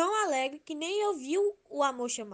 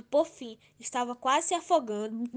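ordinary delivery

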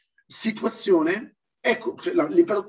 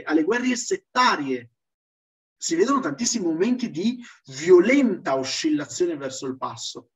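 normal talking voice

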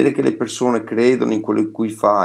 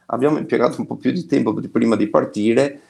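ordinary delivery

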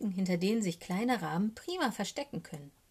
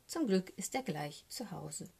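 plain reading voice

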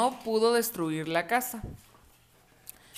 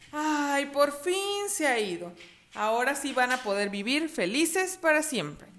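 put-on relieved boy; 5 semitones higher, 4 dB quieter, slower than usual. Relief after wolf flees.

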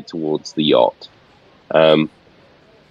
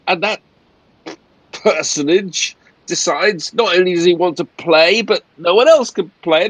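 high-pitched, exasperated character voice; irate captain; 11 semitones higher than usual. Acted. Captain complains to passengers about the Count, irate rant. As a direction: rant indignantly about the injustice. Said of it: "I walk over to the captain".

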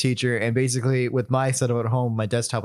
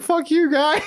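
mock insult; 13 semitones higher than usual. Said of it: "In my head, I was like".

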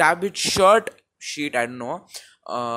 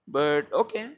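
ordinary delivery

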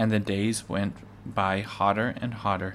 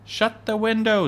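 high, loud urgent call; urgent order; 13 semitones higher, 5 dB louder than usual. A narrator's reading. Ma's urgent order amid invasion.

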